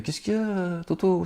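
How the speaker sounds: high falsetto wife asking what is wrong; mock wife voice. Preacher voicing the wife in high falsetto asking her husband what is wrong.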